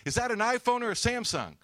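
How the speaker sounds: loud, high-pitched call to a stranger; acted-out hollered question; retold shouted question. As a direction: call the question out across a street.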